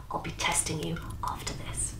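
soft whispered aside; hushed warning. Acted. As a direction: whisper it like a spy secret.

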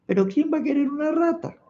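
high, small, whimpering rat voice; tearful little rat. Acted. The crying rat complains to Ganesha, in a high whimper. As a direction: squeak it out like a tiny weeping rat. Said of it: "la ratita estaba llorando".

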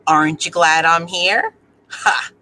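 ordinary delivery

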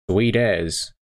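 Kiwi accent on sweet as; broad kiwi accent. Shown as a demonstration. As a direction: say sweet as like a New Zealander. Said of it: "the New Zealand English course".

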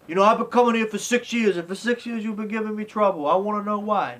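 imitating angry Rocky. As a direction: pitch it up and complain hard.

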